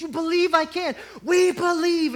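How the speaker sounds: high, loud back-and-forth cheer; excited crowd exchange. Retold or imitated. Blondin and the crowd egging each other on, loud. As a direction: call it out like a stadium crowd.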